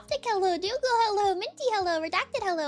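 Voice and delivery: high swooping name, falling hello; singsong shout-outs, each gliding down; sing-song roll call. Real, not acted. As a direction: lilt through a list of greetings. Greeting chat members by name.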